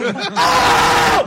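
signature high screaming howl; Kinison scream. Retold or imitated. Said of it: "it's Sam Kinison".

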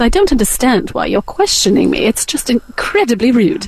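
affronted British lady; put-on British accent. Mom objects to being questioned, affronted British accent. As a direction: play it politely offended in British tones. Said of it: "do the British accent".

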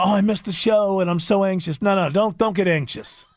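normal talking voice

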